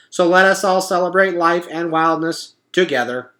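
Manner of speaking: a cheerful machine making a speech; robot voice. A narrator's reading. Roz announcing the party truce to the animals, perky robot.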